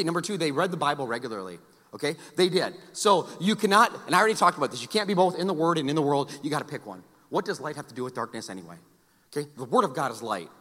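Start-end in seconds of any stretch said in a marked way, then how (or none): none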